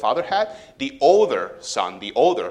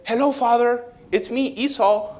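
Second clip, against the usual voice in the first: high, innocent fake greeting; mock-innocent trickster, pitched up; sneaky Jacob; 11 semitones higher than usual. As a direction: put on a sweet voice to fool blind dad. Jacob pretends to be Esau.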